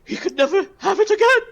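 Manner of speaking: high, loud, mock-tragic wail; anguished Klargard. Klargard mourns the pastry he must forswear, tragic wail. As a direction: cry it out in melodramatic despair. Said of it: "He, he says".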